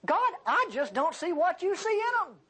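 high-pitched, incredulous accuser; devil complaining to God. Acted. Devil objecting to God about a believer, incredulous. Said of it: "what the devil sa-, would say to God".

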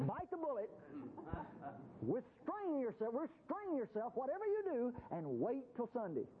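a mock God scolding eager worshipers, held back; mock stern God. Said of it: "Wouldn't it be awful if God says".

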